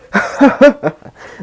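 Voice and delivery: high, gloating evil laugh; villain laugh. Retold or imitated. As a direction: cackle like a villain over a fallen kid. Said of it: "And Shigaraki is like".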